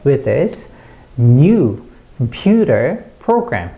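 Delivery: slow, strong "new", "computer", "program"; content-word stress. Shown as a demonstration. Teacher builds the sentence for learners, stressing "new", "computer", "program". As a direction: hit "new", the "pu" of "computer", the first syllable of "program". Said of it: "이렇게 돼야 됩니다".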